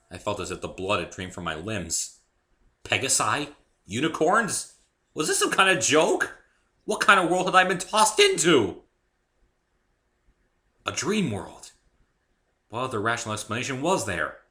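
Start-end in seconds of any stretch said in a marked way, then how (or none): 2.8-11.7 higher, louder alarmed questions; higher, louder mounting alarm; rising disbelief. A narrator's reading. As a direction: panic more with each question. Narrator panicking inwardly.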